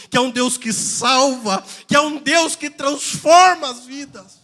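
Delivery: rhythmic, building preaching. Real, not acted. Pastor declaring what God still does, preaching with rising force.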